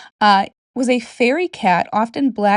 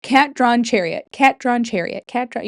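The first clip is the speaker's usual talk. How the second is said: tongue twister repeated fast. Shown as a demonstration. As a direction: say "Cat-drawn chariot" quickly, again and again. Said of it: "Say that five times fast".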